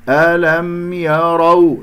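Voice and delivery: "ألم يروا" chanted slowly, م fully sounded; clear م. Shown as a demonstration. Teacher pointing out the م, slow chant of "ألم يروا". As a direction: keep the م of "ألم" distinct before "يروا". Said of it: "انتبهوا إلى الميم".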